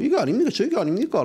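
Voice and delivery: bold questions pitched high; confident, assertive. Retold or imitated. Reporter retells confronting the group, confident and bold. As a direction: challenge them head-on, no fear. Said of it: "당당하게 이야기했죠".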